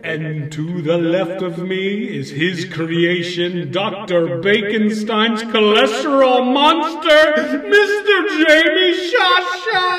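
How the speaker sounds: slow, loud, echoing horror host; spooky echo voice. Acted. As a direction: announce it through an echo. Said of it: "Let me turn that echo off".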